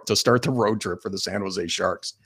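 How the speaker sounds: ordinary talk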